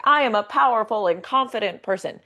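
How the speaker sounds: emphatic confident declaration; power-pose confidence. Acted. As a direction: declare it boldly, standing tall. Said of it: "standing in a power pose, being like".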